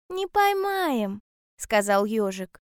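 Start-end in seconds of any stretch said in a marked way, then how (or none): 0.1-1.2 high, drawn-out slow reply; hedgehog voice. A narrator's reading. Hedgehog answering bear cub about clouds, high hedgehog voice.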